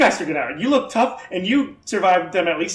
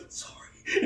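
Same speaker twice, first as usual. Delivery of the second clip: unvoiced whisper; whispered apology as doors shut; whispered sorry; 10 dB quieter than usual. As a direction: breathe it sheepishly. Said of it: "he just goes".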